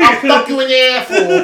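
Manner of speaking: high, loud lisping threat; Mike Tyson impression. Friend doing Tyson's threat, high and loud. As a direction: growl the threat in a squeaky Tyson voice. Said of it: "sound like Mike Tyson".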